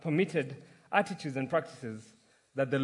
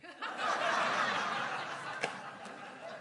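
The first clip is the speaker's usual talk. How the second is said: laughter; 6 dB quieter than usual. Real, not acted. Congregation laughs at punchline.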